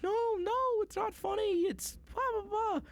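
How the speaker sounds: very high squeaky voice; tiny high-pitched cowering voice; squeaky scared reply. Retold or imitated. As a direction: squeak out a nervous denial like a frightened kid. Timid denial to angry speaker.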